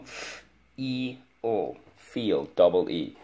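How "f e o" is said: F-E-O as long isolated sounds, high-pitched; sounding out. Shown as a demonstration. Teacher models spelling feel for pupil, sounding out. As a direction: hold each sound of F-E-O separately. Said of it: "Let's go".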